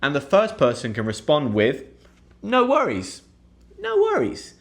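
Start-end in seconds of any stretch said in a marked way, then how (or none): 2.4-3.2 high, airy reassurance; breezy no worries. Shown as a demonstration. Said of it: "a very British way to say". 3.8-4.5 very high, breezy reassurance; sing-song no worries. Shown as a demonstration. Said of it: "a very British way to say".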